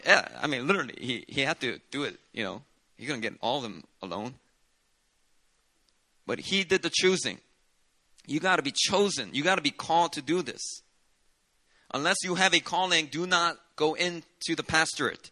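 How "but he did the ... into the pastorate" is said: ordinary talk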